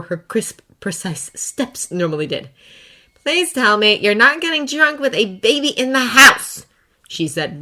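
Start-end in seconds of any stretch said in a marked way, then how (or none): 3.2-6.7 cutting, higher, louder scolding; sharp. Acted. Potts catches Tony drunk with a baby, sharp scolding. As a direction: scold him sharply for drinking. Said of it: "voice sharp".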